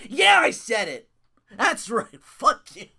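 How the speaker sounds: loud mocking defiance; defiant shout. Real, not acted. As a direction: yell it at him, defiant. Defiant outburst at wrestler.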